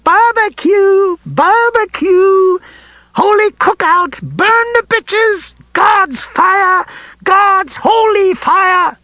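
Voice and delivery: high, slow manic screaming; cackling hell torturer. A narrator's reading. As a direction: scream it with crazed glee. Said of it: "in his screaming, laughing voice".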